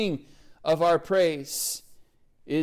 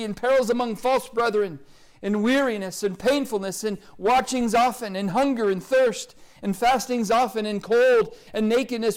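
preaching at full force; 4 semitones higher than usual. Acted. Preacher building to the congregation. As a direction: pile up the hardships with mounting force.

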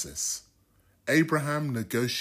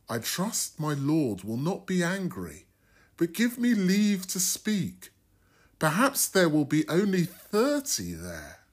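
Abraham, lightly voiced, pressing his case a bit higher; pleading Abraham; 7 semitones higher than usual. Abraham bargaining with the Lord for Sodom, mildly pleading. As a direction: plead earnestly for the just men.

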